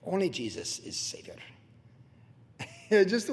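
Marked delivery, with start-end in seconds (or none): 0.0-1.3 put-on Russian accent. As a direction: imitate a Russian speaker, deep and deliberate. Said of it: "with his Russian accent".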